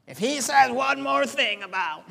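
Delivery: a hint of a grumbling churchgoer muttering to himself; lightly voiced bored listener, half laughing; mock annoyed listener. A churchgoer sick of the sermon, mock grumbling. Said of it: "bored defensive listener imagined".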